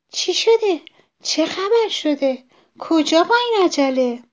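small, high-pitched rabbit voice; scared little rabbit. A narrator's reading. Frightened little rabbit meets the hurrying fox, high alarmed voice. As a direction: squeak anxious questions up high. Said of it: "خرگوش کوچولو که خیلی ترسیده بود گفت".

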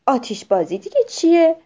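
loud, lightly surprised question; puzzled surprise. A narrator's reading. Owlet asks boy about fireworks, puzzled and surprised. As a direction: ask in baffled wonder. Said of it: "پلاپ با تعجب گفت".

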